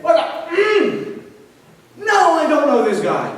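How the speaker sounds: high-pitched mock swearing outburst; acted cursing denial. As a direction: bite back a curse, then deny it. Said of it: "He was cursing them".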